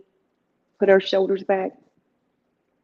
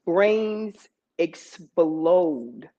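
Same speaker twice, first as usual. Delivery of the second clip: slow emphasis; 4 semitones lower, much slower than usual. Acted. Comic emphasis on shock.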